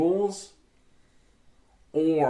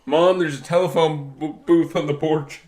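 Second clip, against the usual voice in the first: put-on louder, raised kid calling mom in alarm; mock call to mom; 3 semitones higher, 8 dB louder than usual. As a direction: shout for mom like a scared kid.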